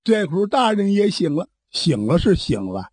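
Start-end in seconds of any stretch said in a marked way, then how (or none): none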